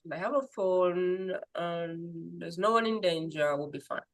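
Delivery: soft, sinking, unworried voice; acted calm. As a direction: reassure yourself quietly despite the fire. Acts staying calm in a fire.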